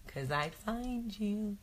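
very soft, drawn-out playful threat; softly stretched, mock-menacing. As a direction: drop to a slow, teasing near-whisper. Playful mock threat to audience member.

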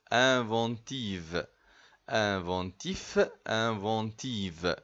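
"Inventive" with a clear final v, "Inventif" with a clear final f, slowly; slow teacher's drill of "Inventif" ending in f and "Inventive" ending in v; slow contrast drill. Shown as a demonstration. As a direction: spell out "Inventif" against "Inventive", slow and careful. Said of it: "فرق ما بين الاتنين".

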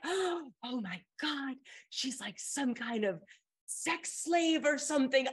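gasp, then high scandalized disbelief; mock horrified realization, rising in pitch; acted shock. Gullible classmate believing the outrageous story, acted shock. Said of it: "my jaw is falling farther and farther".

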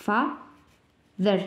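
"father" slow with a voiced th sound; teacher modelling the voiced th for the class; model pronunciation. Shown as a demonstration. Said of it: "We read".

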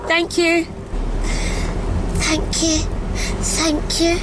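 high, polite fake British thanks; chirpy mock-British politeness; British accent. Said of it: "I think I'm gonna do British today".

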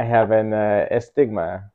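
mock Italian accent, dragging the last word. Interviewer mimics the guest's astigmatism slip in a mock Italian accent. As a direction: repeat it in a put-on Italian accent. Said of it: "I did Italian accent".

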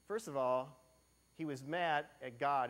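ordinary talk, nothing marked

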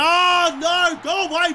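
loud, high cry of protest; real panicked gamer yell; dismayed shout. Real, not acted. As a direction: yell at an opponent to get away. Opponent gets the ball.

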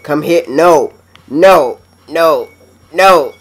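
loud, high pleading; frustrated yelling. Real, not acted. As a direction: yell at the hand to stop. Fighting uncontrollable hand.

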